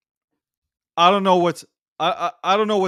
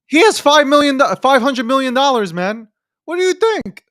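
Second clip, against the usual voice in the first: excited; 6 semitones higher, 9 dB louder than usual. Real, not acted. Excited about his money.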